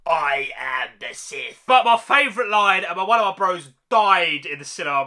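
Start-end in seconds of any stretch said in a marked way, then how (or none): 0.1-1.6 slow low quoting of the villain, lightly voiced; Palpatine impression. Reviewer quoting the Emperor's final line, slow and low. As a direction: drop the pitch and drag out the Emperor's line. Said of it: "lines he said at the end".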